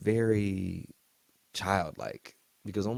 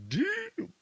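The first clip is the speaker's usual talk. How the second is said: squeaky high disbelief; shocked. Retold or imitated. The host reacting to the shooting, shrill high disbelief. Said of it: "I was like".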